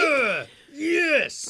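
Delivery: high, drawn-out whoop; proud, hollered congratulations; celebratory cheer. Real, not acted. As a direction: cheer them on with a stretched shout. Cheers a listener's honor roll.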